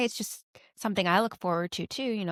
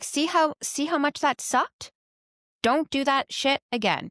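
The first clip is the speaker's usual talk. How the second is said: high, slow, pointed telling-off; retold scolding; 6 semitones higher than usual. Parent scolding child after shaming him, slow and pointed. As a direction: drive the lesson home slowly, pitched up.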